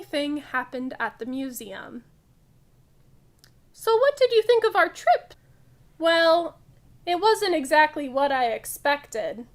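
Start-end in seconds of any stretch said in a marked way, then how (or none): 3.7-9.5 loud, lively picture-book read-aloud; reading to children. Acted. Teacher reading a funny museum story to kids, loud lively read-aloud. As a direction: read it big and bright for the class.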